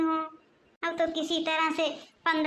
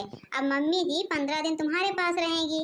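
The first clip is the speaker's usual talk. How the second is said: ordinary delivery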